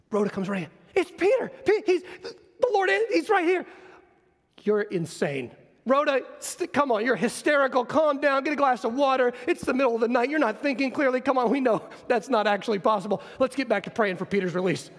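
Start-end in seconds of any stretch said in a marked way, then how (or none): 0.9-3.6 frantic excited cry. Acted. Rhoda bursts in with news. 4.6-14.9 condescending scolding. Acted. Church scolds hysterical Rhoda.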